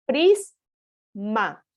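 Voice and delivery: "prisma" split into PRIS, pause, ma; teacher dividing "prisma" into two syllables. Shown as a demonstration. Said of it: "hacer la división".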